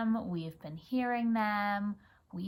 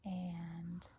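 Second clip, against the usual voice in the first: whispered prompt; 3 semitones lower, 10 dB quieter than usual. Real, not acted. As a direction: whisper a cue to your son. Prompting child to read.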